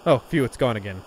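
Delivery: usual speaking voice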